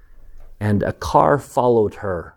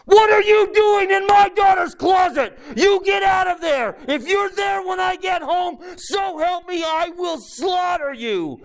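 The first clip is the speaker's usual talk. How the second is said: much higher, hammed-up furious shout; yelling as loud as possible. Dad over the phone to the closet monster, mock furious yelling. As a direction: roar like a dad defending his daughter. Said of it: "yelling as loud as I could".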